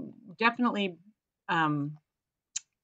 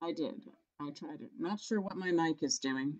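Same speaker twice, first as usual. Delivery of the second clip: quiet mic; 8 dB quieter than usual. Real, not acted. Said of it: "A little bit better now".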